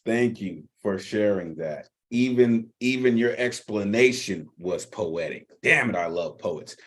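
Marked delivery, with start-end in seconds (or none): none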